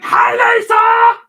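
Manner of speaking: high, loud, drawn-out playful yell; shouted greeting. Retold or imitated. Streamer voicing a viewer's chat greeting, playful shout. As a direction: yell the hello across the room, slowly. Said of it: "she's shouting at me".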